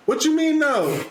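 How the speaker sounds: high-pitched mock protest; acted incredulous comeback; retold indignant disbelief. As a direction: challenge the refusal, pitch jumping up.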